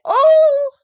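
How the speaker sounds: loud, very high "Oh!"; high rising yelp; going-up cry. A narrator's reading. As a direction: cry out as if tossed into the air. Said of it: "as he went up in the air".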